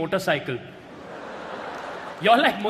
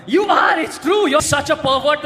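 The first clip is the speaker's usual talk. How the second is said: insisting; 5 semitones higher, 4 dB louder than usual. Acted. Insisting over laughter.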